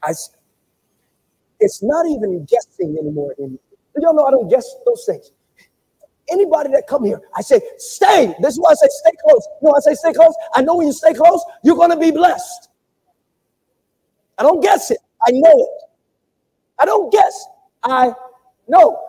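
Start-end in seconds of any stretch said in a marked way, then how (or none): 1.6-7.6 loud, driving preacher cadence; fired-up sermon delivery; preaching at full force. Real, not acted. 7.8-8.4 sharp loud shout of one word; shouted stay; shouted command. Real, not acted. 8.4-19.0 loud, driving preacher cadence; fired-up sermon delivery; preaching at full force. Real, not acted.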